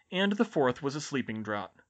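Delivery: ordinary delivery, nothing marked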